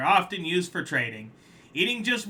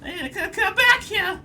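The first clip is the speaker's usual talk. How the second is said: much higher, stammered call, a bit louder; calling after him; 7 dB louder than usual. Acted. Crab calling the collector back, high shout. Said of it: "is what he would be saying".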